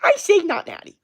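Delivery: loud, piercing high-pitched squeal; Greg Doucette. Acted. Fitness YouTuber ranting on his video, mimicked in a high loud shriek. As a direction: squeal it as an exaggerated impression. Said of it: "on that fucking video and he's just like".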